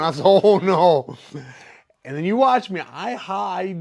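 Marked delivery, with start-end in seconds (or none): 0.2-1.2 pained outcry. Retold or imitated. Felt the foot taking off flip-flop.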